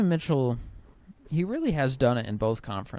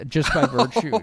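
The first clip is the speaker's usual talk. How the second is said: laughing; 3 semitones higher, 6 dB louder than usual. Real, not acted. Laughs while making his point.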